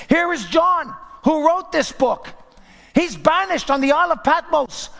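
high, loud, impassioned sermon; full-force preaching. Real, not acted. Preacher proclaiming the New Jerusalem's light at full force. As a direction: proclaim it to the back row.

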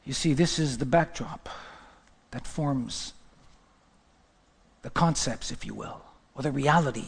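low, soft, grave preaching; hushed and solemn. Real, not acted. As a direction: drop right down into a heavy, sorrowful hush. Solemn picture of grieving Jesus.

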